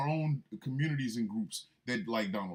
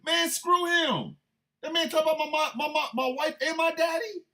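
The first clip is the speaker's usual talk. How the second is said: high, loud mock outrage; hammed-up, high-pitched indignation; indignant outburst; 12 semitones higher, 4 dB louder than usual. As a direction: sputter with comic indignation, voice way up. Indignant over insult to family.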